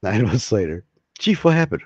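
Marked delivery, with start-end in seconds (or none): 1.2-1.8 doctor voice. Acted. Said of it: "I'll even use my acting voice".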